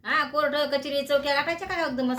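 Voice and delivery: high, loud, exasperated telling-off; exasperated scolding. Acted. Mother scolds quarrelling daughters. (वैतागलेली, उंच आवाजात रागावणे)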